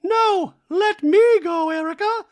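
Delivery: very high, loud put-on voice; hammed-up imitation, drawing the words out; Dorit. Dorit insisting to Erica, mock pleading. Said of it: "And Dorit's like".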